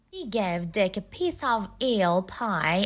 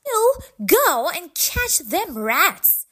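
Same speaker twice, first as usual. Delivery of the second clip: bossy cook; 9 semitones higher, 6 dB louder than usual. A narrator's reading. Loud Cook orders cat.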